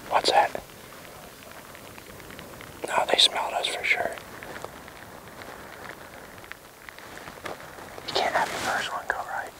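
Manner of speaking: hushed field whisper; wildlife watching whisper. Real, not acted. Whispered debrief after stalk.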